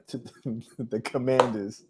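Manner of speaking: laughing through the name; soft, real laugh breaking into the words; can't help laughing. Real, not acted. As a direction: crack up softly before getting the name out. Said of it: "can't say that name without laughing".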